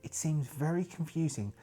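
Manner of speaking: soft, hushed diary reading; journal entry narration. Acted. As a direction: read the journal like a grim investigator's log. Reads journal entry in character.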